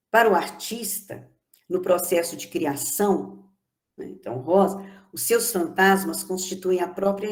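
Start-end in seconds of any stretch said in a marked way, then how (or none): none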